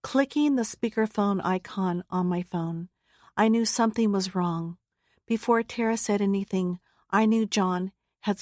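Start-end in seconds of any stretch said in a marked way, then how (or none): none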